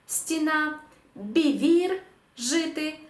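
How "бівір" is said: «бівір» modelled as bi-VIR, pitched up; final-syllable stress. Shown as a demonstration. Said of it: "останній склад".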